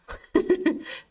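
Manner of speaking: breathy high giggle. Real, not acted. Streamer giggling after her spooky bit. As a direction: giggle at your own joke. Laughs at own performance.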